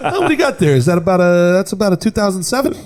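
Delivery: dog small talk. Retold or imitated. Mocking friend's dog talk.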